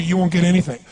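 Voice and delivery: ordinary talk, nothing marked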